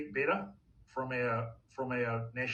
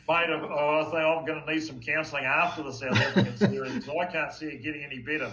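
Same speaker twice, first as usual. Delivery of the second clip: laughing; 6 dB louder than usual. Real, not acted. Jokes about needing counselling.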